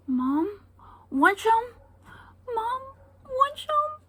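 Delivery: put-on very high sweet toddler voice; toddler offering. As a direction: offer raisins like a tiny girl. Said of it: "and be like".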